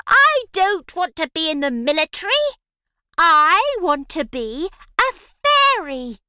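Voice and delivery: high, loud, fast whiny witch. Acted. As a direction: whine like a frustrated witch. Witch complains about the result.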